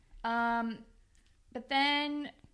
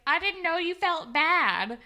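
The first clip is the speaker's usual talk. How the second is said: high, louder mock innocence; imitated clueless excuse; 7 semitones higher, 6 dB louder than usual. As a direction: plead ignorance in a mocking high voice. Voices Holly J's clueless excuse.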